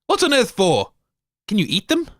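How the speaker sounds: ordinary delivery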